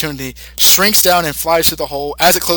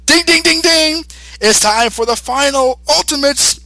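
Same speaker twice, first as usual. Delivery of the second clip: much higher, louder chant, then a slow boast; triumphant kid; 10 semitones higher, 3 dB louder than usual. Acted. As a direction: ring it out like a victory bell. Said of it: "so he says".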